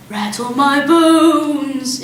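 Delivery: slow, sing-song spooky parrot; soft spooky voice. A narrator's reading. Parrot's soft spooky voice scaring the family, slow and eerie. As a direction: drift the words eerily. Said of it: "soft and spooky this time".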